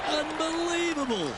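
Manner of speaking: drawn-out high amazed call; excited. Real, not acted. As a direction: marvel at the hole-in-one. Hole-in-one call.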